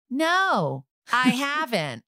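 high-pitched, unconvincing denial; terrible liar. Retold or imitated. Mother denying she has seen Kristen, badly faked innocence. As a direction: lie badly, far too high. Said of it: "like, a terrible liar".

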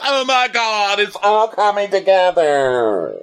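high, drawn-out swooning realization; hammed-up awestruck squeal; mock amazement. Acting out own thrilled reaction.

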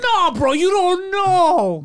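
imitating friend.

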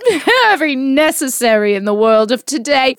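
put-on British accent that cracks up into laughter. Mom reads a fan review in a mock British accent, laughing. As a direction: keep the British accent going through the giggles. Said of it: "Do your British accent".

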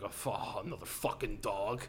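low, soft grumble, imitating a dad; put-upon father's low grumble; grumbling dad. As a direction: mutter it like a grudging dad. Dad grumbling about another dog.

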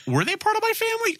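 very high, silly questioning voice; mocking imitation. Mocking the spider's guessing about kin eater, exaggerated naive squeak.

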